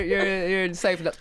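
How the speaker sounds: normal talking voice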